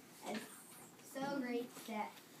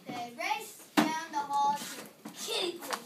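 loud, higher, slowed reading; excited; 4 semitones higher, 9 dB louder, slower than usual. Acted. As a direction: announce it like a big moment. Dramatic loud storybook line.

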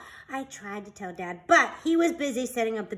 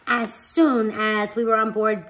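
ordinary delivery

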